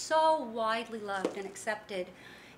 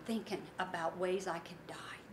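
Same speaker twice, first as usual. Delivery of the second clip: whisper; 7 semitones lower, 7 dB quieter than usual. Retold or imitated. Widow confiding suicidal thoughts.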